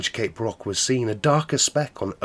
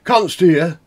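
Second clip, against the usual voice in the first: anxious sailor; 9 semitones higher, 11 dB louder than usual. Acted. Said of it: "said one".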